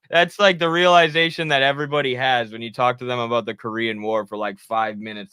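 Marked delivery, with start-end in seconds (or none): none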